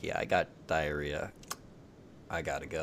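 low, hushed confession; quietly said excuse. Acted. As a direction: say it quietly and low, under your breath. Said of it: "quietly say".

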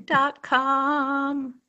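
laughing through the words; squealing with laughter. Real, not acted. Joking about fake ad.

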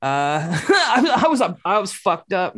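laughing through the words; chuckling while admitting it. Real, not acted.

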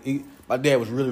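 ordinary delivery

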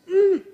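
high, loud strained "mm"; suppressed urge hum. Retold or imitated. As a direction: hum high as if fighting temptation. Imitates himself itching to check phone.

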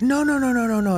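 high, loud panicked no's; a retold terrified outburst, voice jumping way up; freaked-out panic. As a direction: panic like something's got hold of you. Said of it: "he freaks out".

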